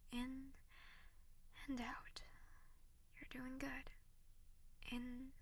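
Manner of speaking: slow whispered breathing guide; calm them down, slow and hushed; soothing whisper. Acted. Guiding deep breaths.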